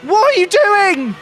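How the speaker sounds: high, loud panicked yell at the driver; panicked shout. Real, not acted. Streamer yelling at his driver who botched the start, panicked. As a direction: yell at him in disbelief.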